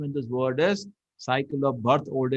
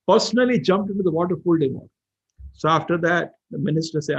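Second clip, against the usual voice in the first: ordinary talk, nothing marked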